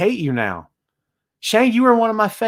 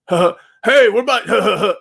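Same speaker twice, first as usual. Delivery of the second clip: mock heckler; 3 semitones higher, 4 dB louder, much slower than usual. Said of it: "It's like Beavis and Butthead".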